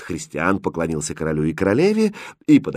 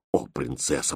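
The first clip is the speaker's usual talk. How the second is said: low, soft moan of dismay; groaning; 6 semitones lower, 9 dB quieter than usual. Acted. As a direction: groan it weakly, a despairing governess. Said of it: "простонала гофмистерина". (со стоном)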